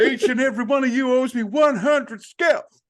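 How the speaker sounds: high, loud film-character impression; Lando Reigns. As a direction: belt out a hammed-up commander's demand. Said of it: "Lando Reigns".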